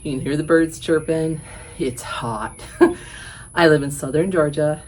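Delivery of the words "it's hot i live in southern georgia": slightly winded from the heat; out of breath. Real, not acted. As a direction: talk a bit short of breath. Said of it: "so I'm a little out of breath".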